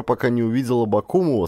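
ordinary delivery

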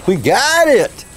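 real delighted shout; excited. Real, not acted. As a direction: celebrate the save. Flip worked.

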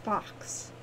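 hushed, near-whispered address; softly. A narrator's reading. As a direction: say it gently under the breath. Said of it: "he said softly".

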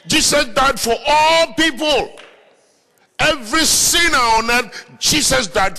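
high, emphatic preacher's voice; impassioned preaching. Acted. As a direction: proclaim it, stretching the key words. Preaches at full force.

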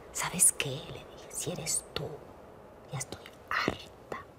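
slow whispered defiance; weary whisper, fed up with life; whispered challenge to God. Retold or imitated. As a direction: whisper your anger upward. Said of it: "empecé a retar".

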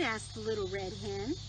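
gentle storybook reading voice; reading a story to children. Acted. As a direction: read it like bedtime story time.

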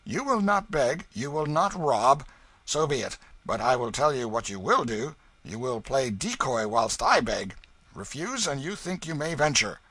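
with temper. A narrator's reading. Hugo ordering the King to play decoy, angry. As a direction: lay down the law angrily. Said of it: "Hugo replied with temper".

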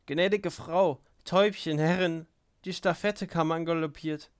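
high, loud, excited calling out; joyful, breathless. A narrator's reading. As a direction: burst in with thrilling news. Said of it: "rief sie freudig und ganz außer Atem". (freudig gerufen, ganz außer Atem)

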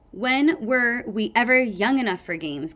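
ordinary delivery, nothing marked

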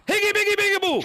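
high excited shout; group scream. Acted. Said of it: "we're gonna scream".

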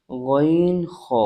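গইন খ stretched very slowly, rasped from the back of the throat; very slow gh and kh from the throat's end; slow Arabic letter demo; drawn-out Arabic letters. Shown as a demonstration. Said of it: "শেষ ভাগ হইতে উচ্চারণ হয়".

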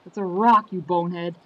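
annoyed retort. Retold or imitated. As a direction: put down your dim-witted buddy. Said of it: "And then Crunch is like".

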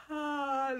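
open-mouthed sigh on "ah"; long releasing ah. Shown as a demonstration. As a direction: let out a sigh, mouth wide. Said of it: "letting out a sigh".

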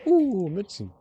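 überrascht. Real, not acted. Findet Münzen.

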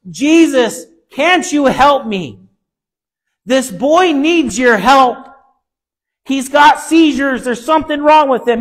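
slow, pleading father crying out for help, rising to a shout; desperate father pleading. Retold or imitated. Father begs Jesus to heal his son, slow desperate plea. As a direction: beg Jesus slowly, breaking into a cry on the seizures.

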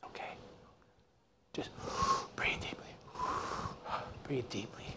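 whispered coaching with deep breaths; barely audible, breathing along to settle someone; calming whisper. Retold or imitated. Scuba instructor coaching a panicking student to breathe, soothing whisper. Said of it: "he goes like this".

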